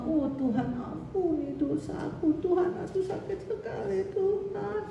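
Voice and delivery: high, soft, pleading prayer to God with a laugh; imitating a sick man begging God; mock pleading prayer. Quoting a sick man praying to God, high pleading.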